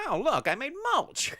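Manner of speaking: high, loud cackling villain voice; gleeful villain. Retold or imitated. Retelling how Skeletor gloats over Moss Man, high mock villain voice.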